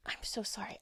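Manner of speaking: breathy whispered apology; whispering. Real, not acted. Apologizes mid-read.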